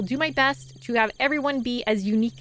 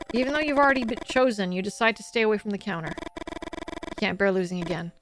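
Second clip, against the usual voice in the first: low, measured narrator voice; story narration; 5 semitones lower, 4 dB quieter than usual. A narrator's reading. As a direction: read the story in a subdued, lower voice. Reads the game's story aloud.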